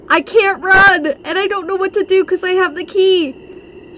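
high, loud frantic wail; panicked. Real, not acted. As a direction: cry out in helpless panic. Trapped and unable to run.

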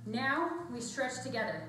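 usual speaking voice

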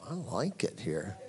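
low, slow, very soft; quiet savoured thought. Retold or imitated. Preacher retelling his thought in God's presence, hushed and slow. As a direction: murmur it slowly to yourself.

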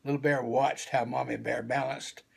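ordinary delivery, nothing marked

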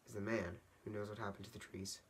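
soft storyteller's hush; confidential. Acted. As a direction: let them in on a secret. Grandma secretly tells of Onceler.

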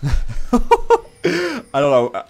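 slow, loud laugh through the words. Real, not acted. Player laughing at his own wall-jump misplay. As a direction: laugh at your own blunder.

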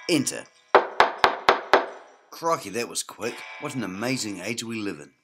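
ordinary talk

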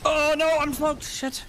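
high, loud sudden alarm; alarmed cry. Real, not acted. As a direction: blurt it out in panic. Caught by enemy smoke.

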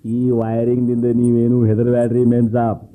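ordinary delivery

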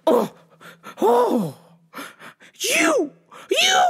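high, halting speechless stammer; mock someone lost for a comeback, rising to a squeak; thrown-off sputtering. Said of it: "they are so thrown off".

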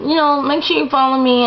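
ordinary delivery

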